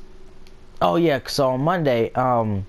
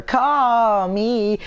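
higher, louder put-on voice; friend's odd voice; 10 semitones higher, 4 dB louder than usual. Streamer imitating friend's random outburst, put-on voice. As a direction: blurt call me in a strange character voice. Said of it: "He'll just come out of nowhere".

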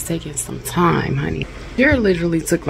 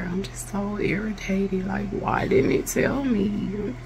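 slow, softer, worn-out complaint; close to crying; 3 semitones higher, 5 dB quieter, slower than usual. Real, not acted. Telling viewers the instructions failed her, slow and close to tears. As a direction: let the words sag, on the edge of tears. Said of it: "I'm definitely finna cry".

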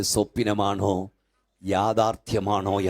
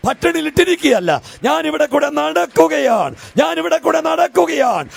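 fiery sermon climbing to a shouted, sung peak; preaching at full force; 9 semitones higher, 9 dB louder than usual. Acted. Preacher rousing the congregation, building to a shout. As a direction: preach it, rising higher and louder.

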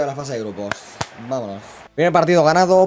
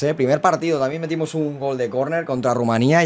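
ordinary talk

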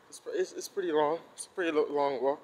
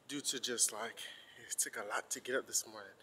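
normal talking voice

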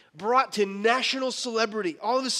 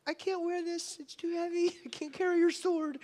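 put-on boy's voice; 9 semitones higher, 3 dB quieter than usual. Boy David in heavy armor.

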